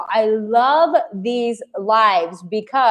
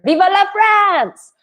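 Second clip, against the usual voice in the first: excited cheer; 11 semitones higher, 4 dB louder than usual. Pretend. Cheering for France birthday.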